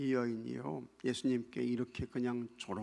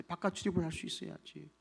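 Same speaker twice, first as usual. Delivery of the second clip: ordinary talk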